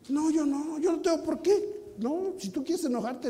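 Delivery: ordinary talk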